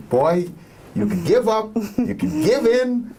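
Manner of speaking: high-pitched, emphatic grandmother impression; high imitated granny scolding, last words stretched; grandmother's voice. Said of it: "in my grandmother's voice".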